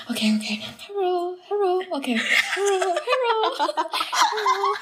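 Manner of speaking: high whispered rehearsal of a mom's hello; nervously practicing an impersonation; rehearsing mom voice. Retold or imitated. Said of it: "I was literally practicing to myself".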